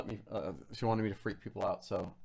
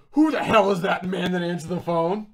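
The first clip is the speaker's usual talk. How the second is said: laughing quote; 6 semitones higher, 11 dB louder than usual. Retold or imitated. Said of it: "He goes, he goes".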